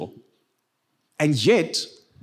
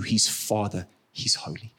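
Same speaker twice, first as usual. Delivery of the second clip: soft, low, whispered; reverent whisper; 7 semitones lower, 6 dB quieter than usual. Real, not acted. Pastor whispering what believers should remember about God. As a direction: breathe it like a prayer.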